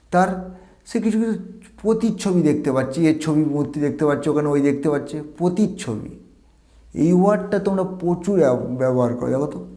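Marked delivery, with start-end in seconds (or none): none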